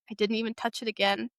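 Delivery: ordinary delivery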